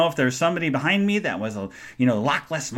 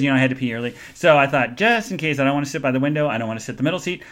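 normal talking voice

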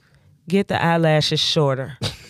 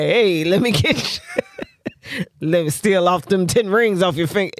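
put-on old pimp voice. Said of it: "an old pimp voice".

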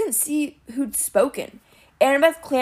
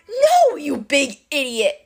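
slow, higher-pitched exasperated bellow; 5 semitones higher, 3 dB louder, slower than usual. A narrator's reading. Captive Clarice corrects the dim Cyclops, exasperated bellow. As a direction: bellow it out, dragging the words. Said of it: "Clarice bellowed".

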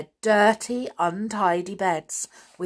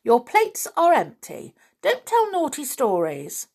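scolding aunt; 7 semitones higher than usual. A narrator's reading. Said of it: "said Aunt Jane".

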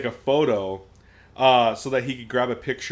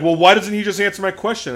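fast, loud, high retort; heated mock outburst, pitched up; imitated angry demand; 6 semitones higher, 7 dB louder, faster than usual. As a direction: imitate an irate man demanding an answer. Retold angry man demanding answers.

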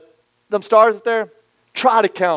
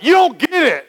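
high, pleading you don't get it; frustrated protest; 9 semitones higher than usual. Retold or imitated. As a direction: protest that nobody understands. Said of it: "you're going like".

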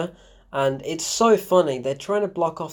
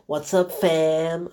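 drawn-out, hammed-up slang greeting; mock Brit greeting; 2 semitones higher, much slower than usual. Reviewer mimicking the actor's cringey line, mocking drawl. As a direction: lightly mimic an actor aping a Brit, stretched slow. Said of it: "he just starts imitating a Brit".

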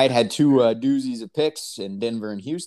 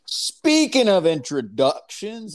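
hype intro; 10 semitones higher, 4 dB louder, much slower than usual. Acted. Hyping up the intro.

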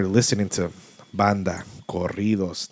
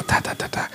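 knocking sound; 6 dB quieter than usual. Retold or imitated. Knock on the car window.

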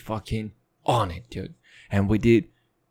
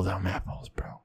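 whisper; 6 semitones lower, 10 dB quieter than usual. Pretend. Whispered boast.

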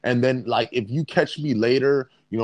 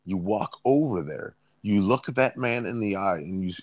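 ordinary talk

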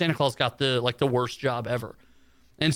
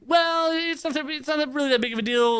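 reluctant hedging; 11 semitones higher than usual. Retold or imitated. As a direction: stall in a high voice, playing it down. Boss awkwardly downplays the date.

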